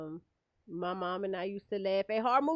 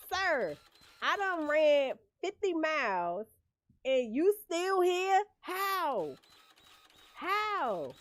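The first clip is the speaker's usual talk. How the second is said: higher, louder, slowed, disbelieving whine; a worn-out victim who can't escape him; exasperated victim; 5 semitones higher, 3 dB louder, much slower than usual. Retold or imitated. Horror movie victim pleading with Michael Myers, exasperated disbelief. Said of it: "he would be up on you like".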